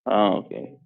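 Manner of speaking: normal talking voice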